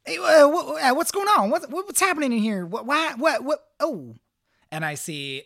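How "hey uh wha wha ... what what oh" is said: higher, louder flustered questions; startled stammer. Acted. Jeffy stumbles in, startled by the scene. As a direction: burst in confused and alarmed. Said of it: "he's like".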